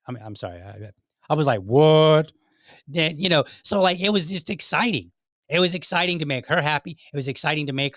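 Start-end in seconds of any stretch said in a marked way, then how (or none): 1.6-2.3 loud, stretched-out "what"; acted astonishment. Husband retelling his reaction to wife's praise, astonished. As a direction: blurt it loudly in mock disbelief.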